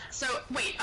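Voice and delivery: ordinary talk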